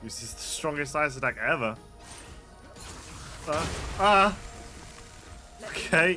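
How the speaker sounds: loud, hyped up; excited yelling. Real, not acted. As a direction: shout it in the heat of battle. Reacting to a strong attack.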